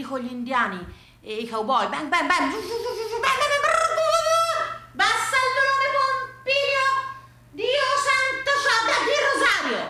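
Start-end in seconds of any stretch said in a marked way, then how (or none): 1.9-4.9 high, climbing shoot-out noises; imitated gunfire. Television western blaring at grandfather, imitated gunfire. 4.9-9.8 exasperated shriek. Acted. Wife yells at Pompilio over the TV volume, shrieking.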